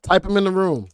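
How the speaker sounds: loud, high call to the chat; rally the viewers to type in the room; hyped shout to the chat room. Real, not acted. Urging chat to type stocks.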